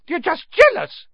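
very high, loud squeak; shrill schoolboy retort; piping boy. A narrator's reading. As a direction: pipe up defiantly in a little boy's voice. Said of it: "piped up Colin".

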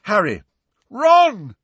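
loud, high, urgent call. A narrator's reading. Angelina hurrying to Harry and Ron, calling desperately.

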